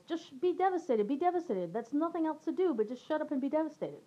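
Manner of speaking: exasperated yell held back in volume; retold yelling. Friend on the phone ordering her to be devastated, mild yell. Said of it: "she yells".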